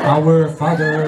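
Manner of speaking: low, slow, solemn prayer; captain giving up and starting to pray. Acted. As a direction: intone it slowly like a prayer. Captain starts praying, punchline.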